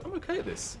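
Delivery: lightly voiced cocky gamer thinking aloud; smug inner voice. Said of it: "you just go".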